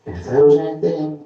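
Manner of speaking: ordinary delivery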